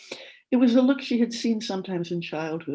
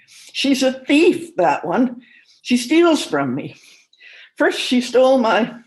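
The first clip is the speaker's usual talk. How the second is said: put-on old man's voice, higher, louder and slow; suspicious old father; 7 semitones higher, 8 dB louder, slower than usual. A narrator's reading. Old father accusing daughter-in-law, loud and slow. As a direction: accuse her slowly, sure she steals from you.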